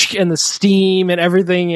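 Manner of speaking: ordinary talk, nothing marked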